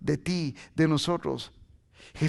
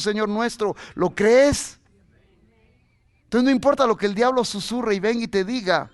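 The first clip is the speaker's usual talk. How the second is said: loud, higher, emphatic preacher; full-force preaching; 5 semitones higher, 6 dB louder than usual. Real, not acted. Preacher assures the church nothing can separate them from God, full-force preaching.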